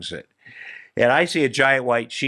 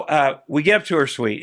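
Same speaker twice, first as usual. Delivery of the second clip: ordinary delivery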